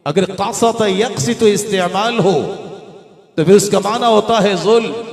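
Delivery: high, loud scholarly proclamation; full-force preaching. Real, not acted. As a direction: ring out each citation to a big congregation.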